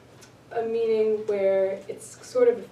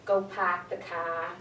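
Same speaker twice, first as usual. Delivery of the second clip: Boston accent; 3 semitones lower, 4 dB quieter than usual. Shown as a demonstration. Teacher demonstrating a Boston accent to class, slow and clear. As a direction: drop the r in park and car, pahk the cah. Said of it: "now listen".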